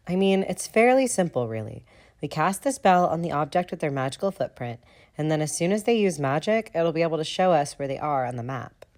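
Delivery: Lily's voice. A narrator's reading. Lily's voice continues.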